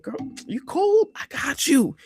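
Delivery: squeaky high reassurance. Retold or imitated. Reassuring scared friend, mock tender.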